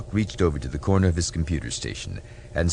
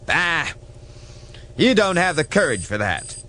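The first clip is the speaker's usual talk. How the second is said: higher, scoffing admiral voice; scornful mutinous admiral; 12 semitones higher than usual. Acted. Mutineer admiral taunts Captain Garson, scornful and higher. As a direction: sneer at the captain's threat.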